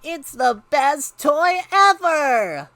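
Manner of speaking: put-on high, drawn-out, loud kid excitement; over-the-top joyful outburst; ecstatic shout. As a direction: shout it with huge delight. Boy raves about the box.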